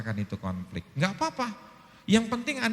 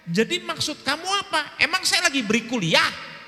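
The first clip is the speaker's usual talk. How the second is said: much higher, louder indignant question; retold angry challenge; 13 semitones higher, 5 dB louder than usual. Husband snapping at wife taking notes, indignant challenge. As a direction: demand an answer, offended.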